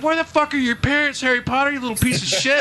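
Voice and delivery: high mock yell at the kid; mock exasperated retort. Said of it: "I was like".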